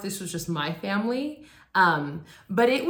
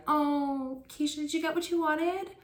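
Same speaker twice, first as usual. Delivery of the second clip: much higher, sugary adult voice; high, sing-song grown-up fussing; gushing adults; 9 semitones higher than usual. Retold or imitated. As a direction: coo it like eager relatives.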